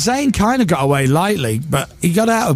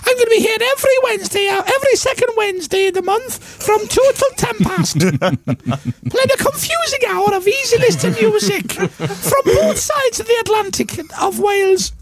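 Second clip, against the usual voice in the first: put-on little girl voice. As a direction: play the little sister presenter. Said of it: "Malaria and Alice".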